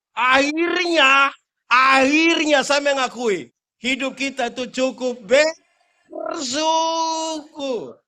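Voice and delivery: loud, slow, drawn-out preaching; preaching climax. Real, not acted. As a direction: drive home gratitude. (berkhotbah keras dan lambat)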